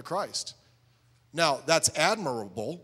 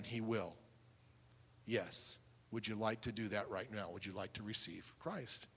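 soft, low, unhurried question; earnest gentle invitation; 4 semitones lower, 6 dB quieter than usual. Shown as a demonstration. Soul winner inviting someone to receive Christ, gentle and sincere. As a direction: ease down into a quiet, sincere appeal.

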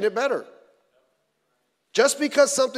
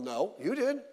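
ordinary delivery

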